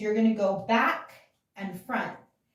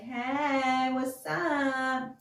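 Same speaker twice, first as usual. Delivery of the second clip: drawn-out, higher "hey"; sing-song mock greeting; acted flirty greeting; 5 semitones higher, much slower than usual. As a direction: play a lazy, stretched-out hello. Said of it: "being like".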